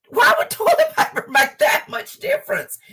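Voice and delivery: high, laughing disbelief; giggling in disbelief at her husband's excuse; laughing question. Retold or imitated.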